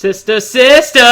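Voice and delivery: excited call-out. Real, not acted. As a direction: shout the sitcom title in mock delight. Friend calls out sitcom title.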